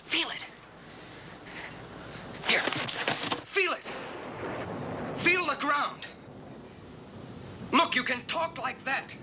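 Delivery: pushing her to feel it, overjoyed; thrilled. Real, not acted. Excited teaching, stamping ground.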